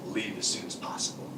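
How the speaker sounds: hushed, secret warning; urgent whisper. Acted. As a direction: whisper it, urging them to go now. Cat urges kids to flee.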